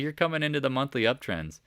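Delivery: usual speaking voice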